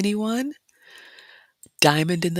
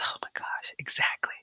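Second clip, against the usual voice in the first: hushed excited agreement; whisper; 7 dB quieter than usual. Real, not acted. Vlogger agreeing with a viewer about donuts, whispered. Reacting to red velvet donut comment.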